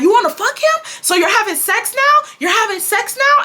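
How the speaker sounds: high, outraged questioning imitation; hammed-up scandalized mother; mom going off. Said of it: "she's just going off on me".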